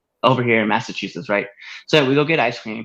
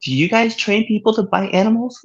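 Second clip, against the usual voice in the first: mock lady; 7 semitones higher than usual. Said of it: "She goes".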